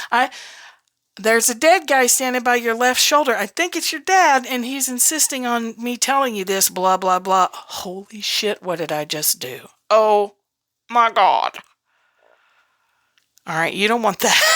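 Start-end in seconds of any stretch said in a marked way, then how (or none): 0.1-7.5 blurting medium. Retold or imitated. At a dinner with bankers, a medium blurting excitedly. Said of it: "you'll be saying". 7.5-9.7 mortified aside. Retold or imitated. Horrified afterthought. 9.9-11.6 a loud Oh my God; dismayed gasp. Retold or imitated. Realizing the blunder at dinner, a loud shocked Oh my God.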